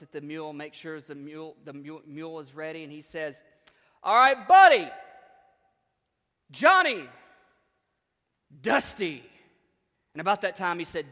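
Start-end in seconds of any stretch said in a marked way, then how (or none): 4.0-9.2 loud, high calls to the mules; calling out names. Retold or imitated. Farmer shouting names to rouse his mule, loud. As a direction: rouse the team.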